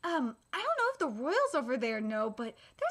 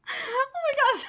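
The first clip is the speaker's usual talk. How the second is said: cracking up; 10 semitones higher than usual. Real, not acted. Reader reacts to funny manga exchange, helpless laughter. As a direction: gasp it out through squealing laughter.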